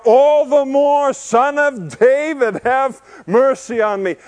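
high, loud, slow desperate cry. Retold or imitated. Beggar crying out to Jesus despite the crowd, loud and slow. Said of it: "But he cried out".

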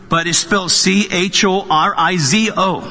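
usual speaking voice

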